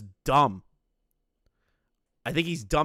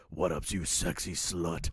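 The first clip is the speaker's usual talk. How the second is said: low, slow mock greeting, softer, laughing; deep Mama Max voice; 8 semitones lower, 5 dB quieter than usual. Picturing Max answering a friend's call, deep slow mock voice. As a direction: drop the voice low and drawl it out.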